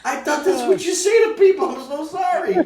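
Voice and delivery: high, loud flustered pleading, retold for laughs; mock panicked apology. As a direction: plead your innocence in a comically high voice. Retold apology after being yelled at.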